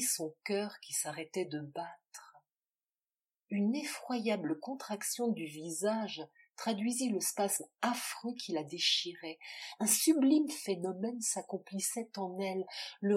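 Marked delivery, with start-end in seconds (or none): none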